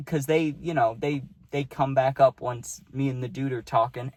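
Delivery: ordinary delivery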